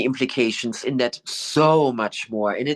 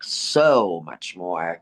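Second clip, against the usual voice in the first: very slow "so much more", each word leaned on; slow drawn-out "so much more"; emphasis; 5 semitones lower, much slower than usual. Shown as a demonstration. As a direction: draw "so much more" out slowly and firmly. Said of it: "really say it with that emphasis of".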